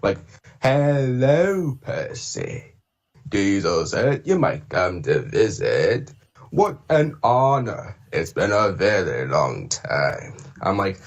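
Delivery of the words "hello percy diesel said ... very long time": slow, drawn-out villain voice with a breathy hiss on the name; menacing engine villain, savouring each word slowly; sly, slow cartoon villain greeting; Diesel 10 impression. Shown as a demonstration. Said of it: "I was doing an impression of him in Day of the Diesels".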